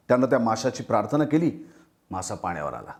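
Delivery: ordinary delivery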